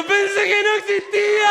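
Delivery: high-pitched, hammed-up crying out; hysterical, mock-tearful cry; wailed confession. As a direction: wail it back at your girlfriend. Tearful confession after the scare.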